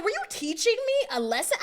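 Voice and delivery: high, loud accusing question; indignant shout. Retold or imitated. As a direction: throw the accusation back at him. Angry confrontation on FaceTime.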